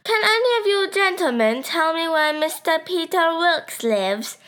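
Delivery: high-pitched put-on English accent; funny English accent. A narrator's reading. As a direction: ask in a hammed-up English accent. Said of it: "asked in his funny accent".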